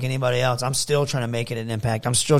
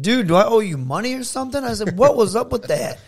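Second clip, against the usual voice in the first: raised, incredulous challenge; retold indignant confrontation; 10 semitones higher, 4 dB louder than usual. Wrestler confronting his opponent backstage, indignant. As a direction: demand an explanation, pitched well up.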